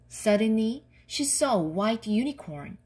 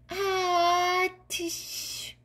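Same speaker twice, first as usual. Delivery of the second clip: acted sneeze, high held wind-up; dragon sneeze; 9 semitones higher than usual. A narrator's reading. Dragon smells the lemon, acted sneeze. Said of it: "went the dragon".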